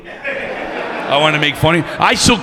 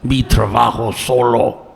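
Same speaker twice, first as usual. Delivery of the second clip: grumpy cleaning lady; slower than usual. Retold or imitated. Miserable cleaning lady answers.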